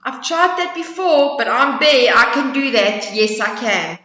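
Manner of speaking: loud, proud bear voice; boastful bear. Acted. Bear boasting to the squirrel, loud proud voice. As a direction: boom the brag.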